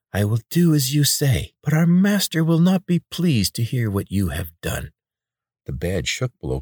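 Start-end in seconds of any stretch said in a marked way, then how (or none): 0.1-4.9 high nasal voice, sour and resentful; bitter nasal voice. Acted. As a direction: spit out a bitter warning. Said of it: "The nasal voice was low and bitter".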